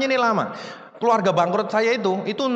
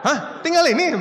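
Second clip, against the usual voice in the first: laughing disbelief; 8 semitones higher than usual. Retold or imitated. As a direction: burst out in amused shock at how little is left. His shocked reaction to the drawing.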